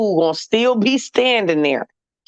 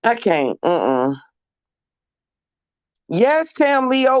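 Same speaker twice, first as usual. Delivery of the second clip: ordinary talk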